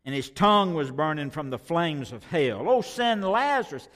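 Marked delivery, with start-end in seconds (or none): 2.7-3.9 high, loud plea; crying out. Acted. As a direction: call out for Lazarus in anguish. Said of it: "he cried".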